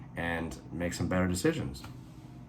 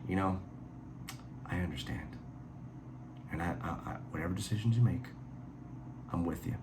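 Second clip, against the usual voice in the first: mock sincere; 9 dB quieter than usual. Mocking Rollick's forgiving reply.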